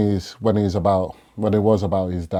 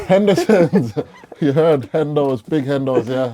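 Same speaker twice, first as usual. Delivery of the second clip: laughing shout; 6 semitones higher, 4 dB louder than usual. Pretend. Calling out to sponsor, laughing.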